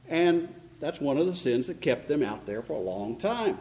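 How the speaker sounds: ordinary talk, nothing marked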